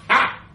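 loud scornful snort. Acted. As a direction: bark one scoffing ha. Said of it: "she snorted".

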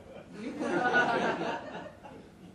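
a short strange laugh; unsettling chuckle before disaster; eerie laugh. A narrator's reading. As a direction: let a hollow laugh slip. Harrison before explosion.

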